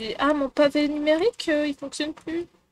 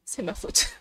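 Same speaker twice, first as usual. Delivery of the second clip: a mock-guilty whisper, much softer; whispered confession; 6 semitones lower, 8 dB quieter than usual. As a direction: breathe it out as a joking confession. Mock self-blame about her name. (chuchoté, faussement coupable)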